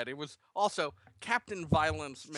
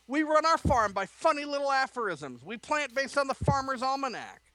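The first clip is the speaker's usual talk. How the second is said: angry shout; 12 semitones higher, 4 dB louder than usual. Acted. Son shouts at father about farm aphorisms. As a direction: shout it out in fury. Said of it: "Jacob shouted in anger".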